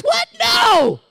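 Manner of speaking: loud high outraged no; mock protest. Teen interrupted mid-match, loud high protest. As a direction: protest like a teen torn from a game.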